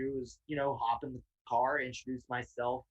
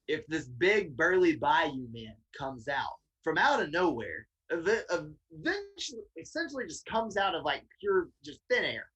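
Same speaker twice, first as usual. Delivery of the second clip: ordinary delivery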